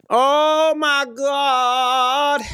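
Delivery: high, drawn-out wail; mock exasperation. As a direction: moan it long and high in disbelief. Exasperated at the hook repeating.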